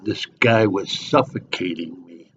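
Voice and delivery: usual speaking voice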